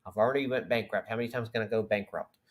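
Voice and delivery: mock worried Alex Jones. Host imagining Alex Jones fretting, low and hurried. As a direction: rattle it off under your breath like a nervous host. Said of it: "And I'm sure Alex Jones is like".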